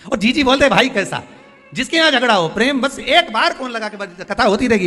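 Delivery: loud, high-pitched exhortation; urgent preaching. Acted. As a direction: press them hard to make the call. Urges congregation to phone siblings.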